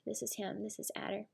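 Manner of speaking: soft aside. Real, not acted. Showing picture softly.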